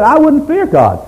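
high, wailing complaint; anguished protest. Retold or imitated. Job protesting his suffering, high anguished wail. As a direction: cry out at the injustice.

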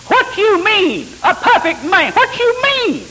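high, loud, drawn-out mock protest; indignant outburst. Seminary student objecting to the teacher in class, high indignant outburst. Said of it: "he just jumped up out of his chair".

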